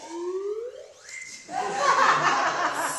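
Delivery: bright burst of real laughter; laughing. Real, not acted. Aunt laughing as she tells the children about the naked goddess. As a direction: laughs out loud at the naked part.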